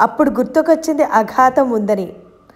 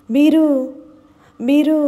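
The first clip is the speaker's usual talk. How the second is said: dazed, unclear; 4 semitones higher than usual. A narrator's reading. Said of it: "అస్పష్టంగా అంది".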